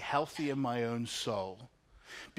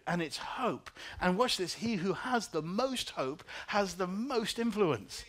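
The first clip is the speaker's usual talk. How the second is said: higher, building preaching voice; rising preaching; 4 semitones higher than usual. Acted. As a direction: preach it toward the climax. Preaching climax on hope.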